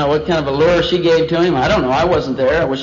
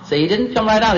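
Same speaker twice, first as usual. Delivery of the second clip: normal talking voice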